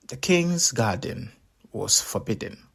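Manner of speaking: plain reading voice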